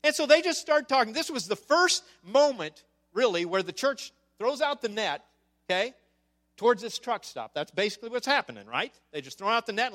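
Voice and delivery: usual speaking voice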